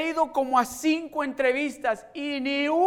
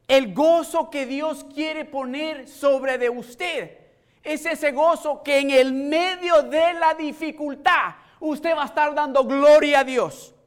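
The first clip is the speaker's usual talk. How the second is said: slow, swelling preacher's call, getting louder; preaching; 5 semitones higher, 8 dB louder, slower than usual. Acted. Pastor to congregation, preaching with building force. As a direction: lift the room with rising fervor.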